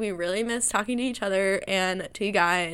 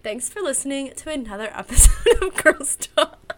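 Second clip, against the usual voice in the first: laughing; 5 semitones higher, 7 dB louder than usual. Real, not acted. Giggling through the outro.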